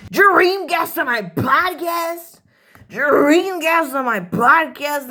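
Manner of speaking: high, slow, gushing cry of delight; hammed-up excitement. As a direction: announce it like a dream come true.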